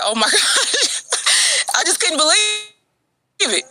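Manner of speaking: slow, high, laughing in disbelief; can't believe it, laughing; high slow laughing gasp of shock. Real, not acted. Shocked reaction to his video.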